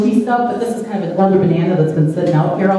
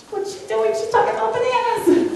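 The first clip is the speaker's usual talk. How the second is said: mock board members; 11 semitones higher, 5 dB quieter than usual. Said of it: "they're probably squirming in their seats".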